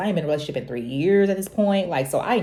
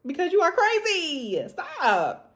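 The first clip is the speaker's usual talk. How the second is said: high, loud, exasperated telling-off; sassy self-scold; scolding herself; 11 semitones higher, 4 dB louder than usual. Retold or imitated. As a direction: snap at yourself like a big sister. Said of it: "but also, like".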